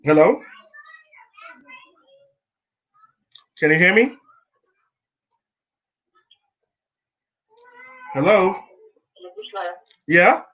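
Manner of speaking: calling loudly into a bad line; call dropping out. Real, not acted. Phone call cutting in and out.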